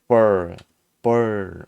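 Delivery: burr loud and drawn out, tongue curled; American final R. Shown as a demonstration. Teacher demonstrating the word ending, strong held burr. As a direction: hit burr and hold its R. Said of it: "이렇게 발음을 한다".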